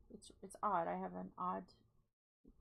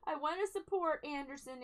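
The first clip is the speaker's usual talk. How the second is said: loud; 5 semitones higher, 5 dB louder than usual. Pretend. Loud cheeky correction after whisper.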